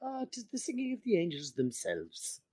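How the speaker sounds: high, soft Irish lilt doing Homer; Irish accent. Retold or imitated. Podcaster quoting Homer's Irish line, in an Irish accent. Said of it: "He does his little Irish accent".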